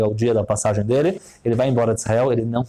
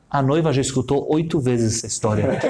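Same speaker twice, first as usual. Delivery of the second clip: ordinary talk, nothing marked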